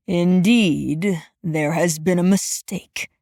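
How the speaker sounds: deep-voiced stern supervisor; gruff old supervisor. A narrator's reading. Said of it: "he agreed".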